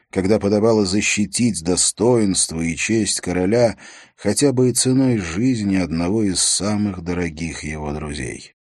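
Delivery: ordinary delivery, nothing marked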